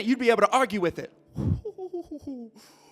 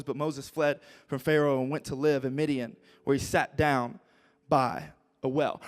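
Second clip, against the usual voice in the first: ordinary talk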